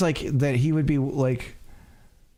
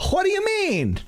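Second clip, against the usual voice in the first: Southern lawyer. Acted. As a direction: play an indignant Southern lawyer. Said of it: "like a Southern lawyer".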